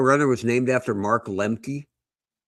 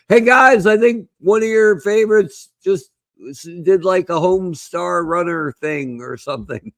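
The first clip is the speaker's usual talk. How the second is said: high, loud call easing off; yelled; 7 semitones higher, 4 dB louder than usual. Retold or imitated. Roommate calling to baseball fans from another room, imitated yell. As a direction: imitate a clueless roommate yelling over. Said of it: "he yelled at him and said".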